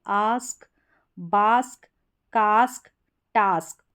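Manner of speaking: "आस्क, बास्क, कास्क, टास्क" each with a long open "aa"; long aa sound. Shown as a demonstration. Teacher reads out example words for learners, long-aa vowel. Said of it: "ए का उच्चारण आ भी होता है".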